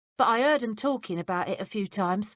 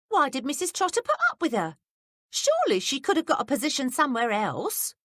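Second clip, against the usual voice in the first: put-on higher, curious young maid; higher maid's voice, prying; 9 semitones higher, 4 dB louder than usual. A narrator's reading. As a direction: play the nosy young maid, pitched up. Betsy voiced by reader.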